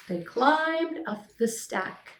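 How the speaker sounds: ordinary delivery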